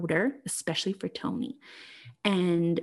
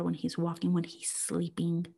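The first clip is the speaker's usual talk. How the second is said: ordinary talk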